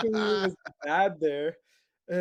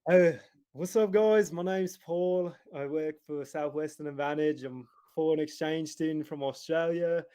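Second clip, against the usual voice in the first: put-on Australian sales pitch; Australian accent. Salesman posing as exchange student to mom and daughter, Australian accent. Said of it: "I pretend to be Australian".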